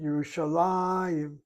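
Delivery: Yerushalayim said slowly with the accent on la, Yerushaláyim; slow teaching demo, stress la in Yerushalayim; stress on second-to-last syllable. Shown as a demonstration. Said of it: "The accent".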